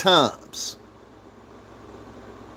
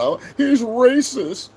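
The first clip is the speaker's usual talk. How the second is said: higher, slow, drawn-out whine; mock whiny accuser; 10 semitones higher, much slower than usual.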